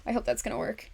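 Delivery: hope it holds, under your breath; doubtful quiet. Real, not acted.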